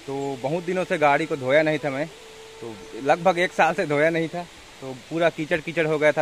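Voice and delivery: usual speaking voice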